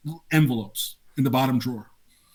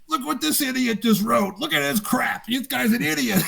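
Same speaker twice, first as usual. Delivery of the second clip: raised, mocking voice with laughter; Berg ranting; 9 semitones higher than usual. Berg mocking a rules question writer, raised jeering voice. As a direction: jeer at the letter writer. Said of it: "and Berg goes".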